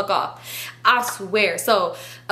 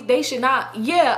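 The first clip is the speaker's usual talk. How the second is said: ordinary talk, nothing marked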